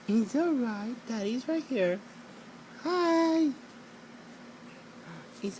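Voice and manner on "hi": usual speaking voice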